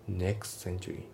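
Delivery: "next century" as "neks-century"; dropped T. Shown as a demonstration. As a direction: run "next century" together without the T. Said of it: "nextのTが抜けますね".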